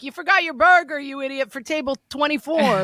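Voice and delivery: high, loud teasing kitchen shout; good-natured holler. Retold or imitated. Cook hollering at waiter about forgotten burger, good-natured holler. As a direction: yell it with no real anger. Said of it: "Hollering is kind of maybe mild frustration, but mostly good-natured".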